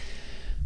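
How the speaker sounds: soft, voiceless mock panting; out-of-breath exhale. Showing involuntary reaction at the top, out of breath. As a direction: puff as if winded from climbing. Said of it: "like, out of breath".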